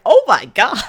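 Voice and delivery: loud, high laughing gasp of shock; real astonishment, laughing. Real, not acted. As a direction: blurt it out in disbelief. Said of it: "I'm like".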